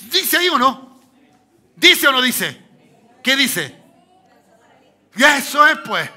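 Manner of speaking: loud, high preaching shout; fiery pulpit cry; full-force preaching. Real, not acted. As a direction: drive it home to the congregation.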